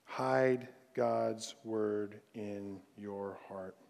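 very slow, low and soft, word by word; measured, hushed admonition; solemn refrain. Real, not acted. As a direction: weigh every word slowly. Slow refrain closing the point.

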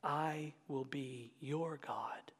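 slow, reverent whisper. Acted. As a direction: breathe the promise over them. Promise read a third time hushed.

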